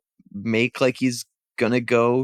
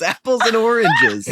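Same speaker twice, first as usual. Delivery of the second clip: louder and higher, hammed up; shouted punchline; 10 semitones higher, 6 dB louder than usual. Shown as a demonstration. Player hams up the comparison, shouting. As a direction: shout the second apples and oranges. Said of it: "repeated louder".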